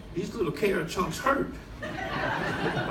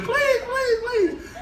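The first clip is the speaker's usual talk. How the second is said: very high, pleading squeal; mock begging; much slower than usual. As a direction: plead desperately in a hammed-up high voice. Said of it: "I'm begging him".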